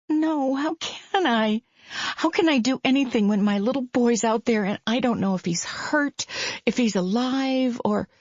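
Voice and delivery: begging to be understood; a hint of a frantic mother; desperate mother. Faith's voice, desperate.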